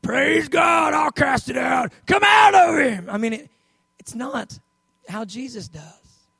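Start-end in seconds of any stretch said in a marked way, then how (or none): 0.0-1.9 loud, high exorcist bluster; mock zealous shout. Believers facing a manifesting demon, mock zealous shouting. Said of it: "and we're like". 2.1-3.1 long, high, very loud command; mock exorcist shout. Believers ordering a demon out, mock frantic shouting. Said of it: "it doesn't say shouted".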